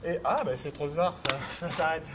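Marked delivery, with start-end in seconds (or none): 1.3-2.1 laughing. Real, not acted. Amused when exercise timing goes wrong.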